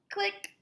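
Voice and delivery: click. Pretend. Camera click.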